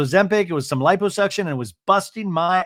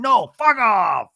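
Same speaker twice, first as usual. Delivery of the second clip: high, loud, drawn-out mock yell; imitated furious yell, words stretched; shouted comeback; 7 semitones higher, 5 dB louder, slower than usual. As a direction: act out blowing up at someone. Same shouted comeback acted again.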